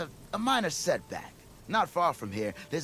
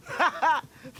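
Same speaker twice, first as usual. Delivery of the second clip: a big, high, loud laugh of relief; delighted laugh; 10 semitones higher, 5 dB louder than usual. Acted. Porguff greets the Nora who will save him, laughing loudly. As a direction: laugh out loud at the sight of her.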